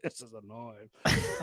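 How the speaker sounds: drawn-out, lazy Aussie voice on knife; slow mock Aussie drawl. Repeating the movie line in a slow mock Aussie voice. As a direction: stretch each word like Crocodile Dundee. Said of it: "you call that a knife?".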